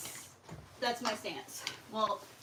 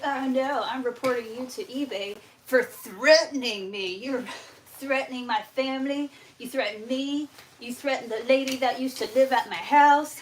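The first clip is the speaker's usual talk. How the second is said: higher, louder theatrical accusations; mock outrage; 3 semitones higher, 6 dB louder than usual. Imitating the scammer reporting her to eBay, mock outraged. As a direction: mimic someone building a dramatic list of grievances.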